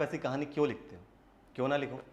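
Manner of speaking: low, soft, almost to himself; quiet rhetorical question. Acted. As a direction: pose it quietly, pitch dropped. Rhetorical question, posed softly first. (धीमी, दबी आवाज़ में सवाल)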